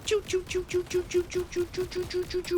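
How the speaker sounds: high, soft choo choo gunfire; high gunshot sound effects. Pretend. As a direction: squeak the gun noises up high. Mouthing gun noises while shooting.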